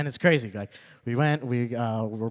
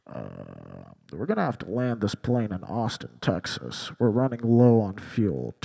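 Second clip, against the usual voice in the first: lower, flat pilot announcement; pilot on the intercom; 6 semitones lower than usual. Retold or imitated. Pilot announces over the intercom, low flat drone. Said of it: "pilot gets on the intercom and goes".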